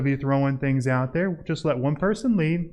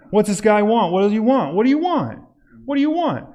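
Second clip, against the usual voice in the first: higher, louder suspicious demand; suspicious homeowner; 7 semitones higher, 5 dB louder than usual. Acted. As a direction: play the wary stranger at the door. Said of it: "What are your thoughts?".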